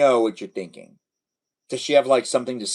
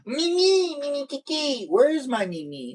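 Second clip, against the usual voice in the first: high sing-song calling; 12 semitones higher than usual. Real, not acted. Calling a viewer sweetly.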